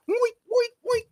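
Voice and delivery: high squeaky oinking; pig oinks. Acted. Pig noise mocking player.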